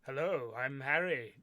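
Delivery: lightly put-on slow, loud greeting; a half-hearted take on a friend, drawn out; slow, loud mock self-introduction; attempted co-host impression. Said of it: "do impressions of each other".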